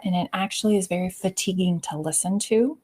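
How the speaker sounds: ordinary delivery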